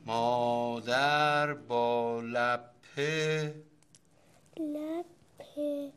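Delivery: very slow, drawn-out syllables; dictation echo. Acted. Child repeats father's dictation while writing, very slow. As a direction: stretch each word while writing it down.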